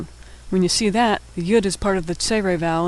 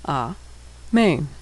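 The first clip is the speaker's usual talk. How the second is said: syllable by syllable; 3 semitones lower, 3 dB quieter than usual. Shown as a demonstration. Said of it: "and final nun".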